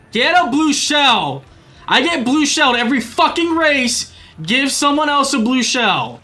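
high, loud, exasperated pleading; frustrated yelling. Real, not acted. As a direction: yell at the game for a blue shell. Pleading for blue shell.

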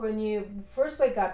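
normal talking voice

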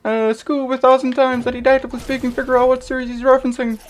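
high, loud, fast cartoon voice; high loud cartoon ranting; Scooby-Doo voice. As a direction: jabber like Scooby in a squeak. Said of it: "Scooby-Doo impression".

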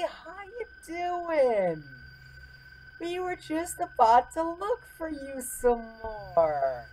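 high, slow, sing-song buddy voice; mock friendly. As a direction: sweet-talk the monster, high and slow. Mock-friendly talk to killer robot.

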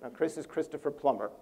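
ordinary delivery

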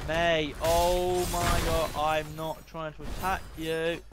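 very slow, drawn-out words; slow, laboured pleading of innocence; exasperated slow protest. Real, not acted. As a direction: stretch every word out in exasperation. Exasperated protest, spelt out slowly.

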